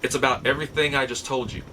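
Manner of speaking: ordinary talk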